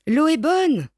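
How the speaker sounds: frère crie joyeusement depuis l'eau, voix plus haute; crié. A narrator's reading. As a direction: appel enjoué, plus haut et plus fort. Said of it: "crie-t-il". (le frère crie depuis le lac, ton joyeux)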